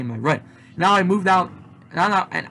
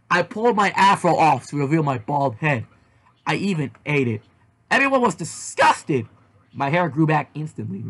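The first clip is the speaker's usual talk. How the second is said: plain reading voice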